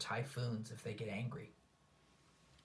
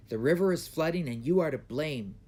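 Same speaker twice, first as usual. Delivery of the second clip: loud, raised accusation; old man accusing the emperor, loud; 4 semitones higher, 9 dB louder than usual. A narrator's reading. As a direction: lay the blame on him hard. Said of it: "said the old man".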